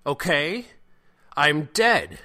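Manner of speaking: high, loud panicked protest; incredulous question bursting into a shout; hysterical outburst. Acted. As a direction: yell it in disbelief.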